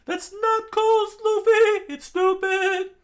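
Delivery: very high loud slow scold; comic high rebuke. Acted. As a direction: shriek the scolding. Chopper scolding Luffy.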